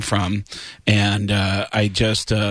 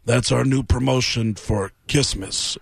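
slow, deadpan rock star voice; lightly voiced, slow and deadpan. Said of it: "And [name] apparently goes".

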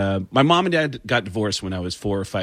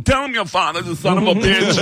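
raised, higher mock-mom voice; mom's bitter order; 7 semitones higher than usual. Mom orders her child to tell the lawyer, mock bitter. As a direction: imitate mom spitting it out. Mom's angry quote in story.

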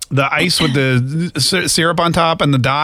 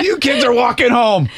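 laughing dad voice; 8 semitones higher than usual. Pretend.

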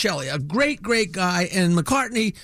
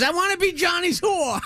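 put-on little girl shouting; 8 semitones higher than usual. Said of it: "She says".

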